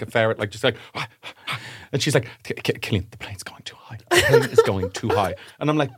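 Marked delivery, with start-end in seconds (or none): 2.4-5.3 imitated panicky whisper, stammering at the start; anxious whisper. Nervous flyer to partner on plane, imitated anxious whisper. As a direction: hiss it under your breath like a scared flyer.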